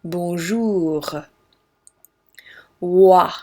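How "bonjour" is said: "bonjour" with a throaty French R at the end. Shown as a demonstration.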